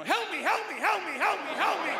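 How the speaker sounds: high, yelped barking plea; frantic dog. Acted. Dog right at your window, frantic yelping. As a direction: repeat it in urgent high yaps. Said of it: "No, you get his attention".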